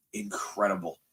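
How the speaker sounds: a breathy whispered repeat of the word. Real, not acted. Repeats it under his breath.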